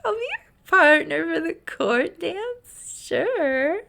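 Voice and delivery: high, breathless, flustered yes; swooning acceptance of the dance; smitten girl. Retold or imitated. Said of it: "she is a smitten".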